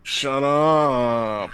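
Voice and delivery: loud, drawn-out "shut up" from bed; sleep-talking protest. Acted. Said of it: "you're talking in your sleep".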